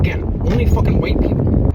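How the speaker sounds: loud, exasperated outburst; a loud, fed-up rant in the car; exasperated shout. Real, not acted. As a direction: throw it out at full volume, fed up. Exasperated rant about hipsters.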